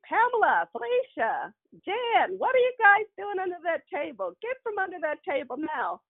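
high calling grandmother voice; mock stern Big Mama; scolding grandmother. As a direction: summon the girls out from under the table.

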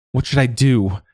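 begging. A narrator's reading. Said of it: "she begged of the water".

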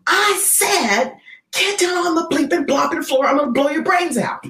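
high, strained threatening yell; mock robber repeating his threat; robber's threat. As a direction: snarl it as the third warning. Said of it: "he says".

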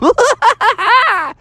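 high cartoon villain laugh. Insane Bear laughs.

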